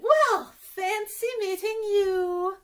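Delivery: high, loud, slow, grand queen voice; fancy queen. Acted. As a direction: play a grand queen, stretching every word. Queen greets the fancy giraffe.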